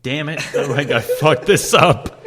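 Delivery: laughing through a retold curse at himself; half-laugh it out, slowed, at your own blunder; sheepish mock groan broken by laughs; laughing self-reproach. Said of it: "And I'm like".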